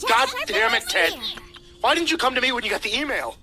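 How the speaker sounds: high, angry yelling at the lawyer; furious yell. A narrator's reading. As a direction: scream it at him in rage. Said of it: "He suddenly yelled".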